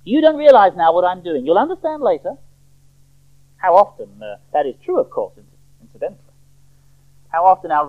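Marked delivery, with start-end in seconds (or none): 0.0-2.4 raised, firm rebuke; loud, insistent correction; emphatic rebuke. Acted. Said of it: "Jesus is just as emphatic in his rebuke".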